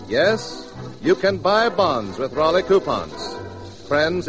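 usual speaking voice

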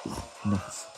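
normal talking voice

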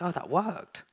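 dog's realisation. Acted. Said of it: "the dog goes".

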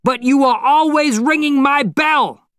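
loud, high accusation; high-pitched outraged shouting; angry shout. A narrator's reading. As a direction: yell the complaint down the stairwell, furious. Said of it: "Mister Yuanashi shouted".

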